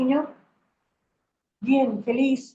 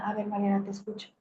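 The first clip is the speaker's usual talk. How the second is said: much softer, low and gentle; soft self-comfort; 3 semitones lower, 8 dB quieter, faster than usual. Shown as a demonstration. To her crying self, stopping to listen, soft and tender. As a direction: stop and speak tenderly to yourself. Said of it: "que Mariana se detenga y que diga".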